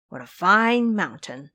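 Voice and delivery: usual speaking voice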